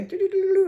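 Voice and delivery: high, quick doo-doo patter; imitated scampering. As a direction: mimic little cubs trotting off. Imitates cubs scampering along.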